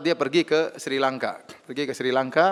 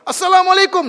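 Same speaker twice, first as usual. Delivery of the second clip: shouted greeting, much higher and louder; 10 dB louder than usual. Retold or imitated. Police chief greeting the idol in the temple, shouting. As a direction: call the greeting out at full volume. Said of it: "dia teriak keras".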